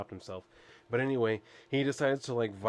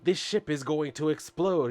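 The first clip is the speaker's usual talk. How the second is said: mock angry outburst, higher; pissed-off Lex Luthor; 5 semitones higher than usual. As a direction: scold like a riled comic-book villain. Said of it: "Lex Luthor is getting all pissed at him".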